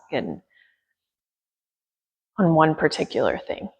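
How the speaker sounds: gentle yoga teacher guiding breath and attention; guided meditation. Acted. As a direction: ease them into stillness with long pauses.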